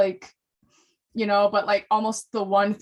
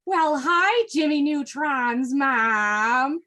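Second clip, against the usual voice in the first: higher, slow, drawn-out Midwestern accent; 4 semitones higher, much slower than usual. Shown as a demonstration. Re-creating a TikTok greeting to Jimmy's mom, Midwestern accent. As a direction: stretch it into broad Midwestern vowels. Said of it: "Jimmy Neutron's mom in my Midwestern accent".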